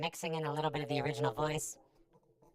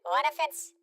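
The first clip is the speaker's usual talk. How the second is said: excited; 12 semitones higher, 4 dB louder than usual. Real, not acted. Excited idea, high jump.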